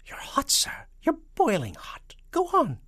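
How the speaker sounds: high, excited spy voice; falsetto. A narrator's reading. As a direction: urge on the guess in excited falsetto. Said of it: "and a falsetto voice".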